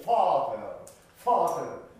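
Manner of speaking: teacher showing the vowel; clear vowel demo. Shown as a demonstration. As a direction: voice the vowel of "ファーテル" fully. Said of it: "アーの母音がファー、ここが明確に聞こえないと".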